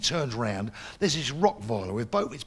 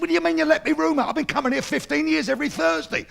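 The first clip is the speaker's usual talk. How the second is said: fast, high, loud indignation; lorry driver protesting to the landlord; outraged protest; 11 semitones higher, 3 dB louder, faster than usual. Retold or imitated. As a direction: demand an answer, furious.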